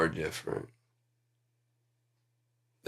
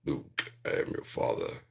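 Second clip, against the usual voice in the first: low, hushed Vader; a breathy Darth Vader rasp; hushed, low Darth Vader misquote; 6 semitones lower than usual. Retold or imitated. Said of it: "'cause he didn't say that".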